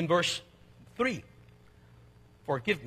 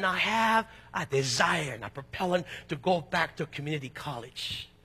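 usual speaking voice

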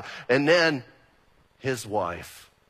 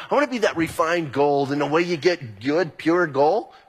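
ordinary talk

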